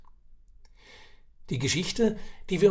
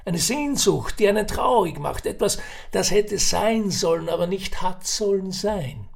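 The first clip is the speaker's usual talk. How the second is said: put-on raised, earnest old Viennese voice; old man explaining; 6 semitones higher, 5 dB louder than usual. Old man explains to Ferdinand what Kränk means, earnest. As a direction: lay out the sorrow like a proverb.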